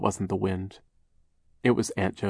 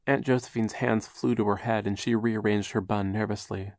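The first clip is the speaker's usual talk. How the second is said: plain reading voice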